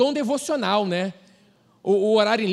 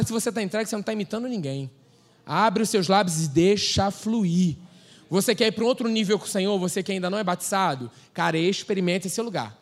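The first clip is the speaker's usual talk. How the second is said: usual speaking voice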